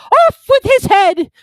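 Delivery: high, loud, slow royal bellow; furious shout. A narrator's reading. Queen sentencing players at croquet, furious slow shout. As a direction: scream the sentence, drawn out.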